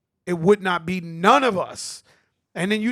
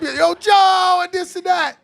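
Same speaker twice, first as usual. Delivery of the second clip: high, loud shout across the room; calling out; 12 semitones higher, 10 dB louder than usual. Retold or imitated. Calling out to Joe across the beef, a high loud shout. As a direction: yell his name to get his attention.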